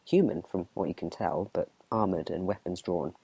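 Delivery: normal talking voice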